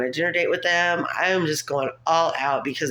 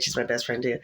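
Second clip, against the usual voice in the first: ordinary talk, nothing marked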